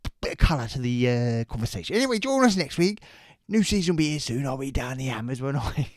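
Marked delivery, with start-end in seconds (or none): 2.0-5.9 cheerful Cockney sign-off, pitched up; Danny Dyer impression. Cockney Danny Dyer signing off to listeners. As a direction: sign off like an East End geezer. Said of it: "Really lost it here".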